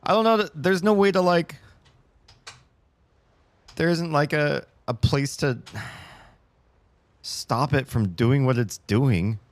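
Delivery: high, loud exasperated rant; vent, flustered and fed up; frustrated. Real, not acted. Frustrated rant about sinking chair.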